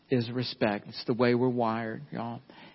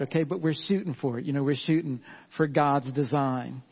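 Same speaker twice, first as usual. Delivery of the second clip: ordinary delivery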